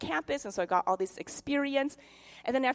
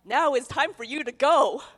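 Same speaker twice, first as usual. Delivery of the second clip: announcer-style urging; 3 semitones higher, 8 dB louder than usual. Retold or imitated. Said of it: "billboards and T V shows would say".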